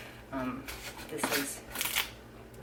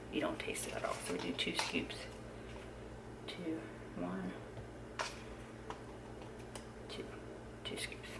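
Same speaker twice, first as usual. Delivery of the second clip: whispering; 11 dB quieter than usual. Real, not acted. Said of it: "I have to whisper".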